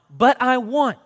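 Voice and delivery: put-on high, loud child's whine; pleading little boy, pitched high; whining boy. A narrator's reading. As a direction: whine it like a four-year-old denied candy. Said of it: "He whined".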